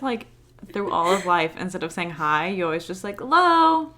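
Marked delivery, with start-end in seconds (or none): 3.2-3.9 playfully belted greeting; mock greeting. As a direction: call it out like a cheery hello. Mock greeting called out as hello.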